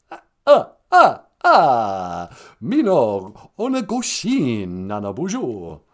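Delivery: loud vampire count laugh then greeting; mock Count greeting. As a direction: ham up a Count calling in. Count caller voice.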